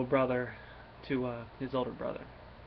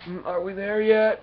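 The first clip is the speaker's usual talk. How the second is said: put-on higher, louder whiny kid turtle asking if they've arrived; Michelangelo voice; 7 semitones higher, 7 dB louder than usual. Sleepy turtle waking on the road trip, higher whiny voice. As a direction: play the impatient youngest brother waking up. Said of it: "I can just hear Michelangelo's voice".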